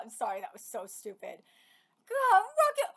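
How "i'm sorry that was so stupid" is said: sheepish apology. Real, not acted. Apologizing after the slip.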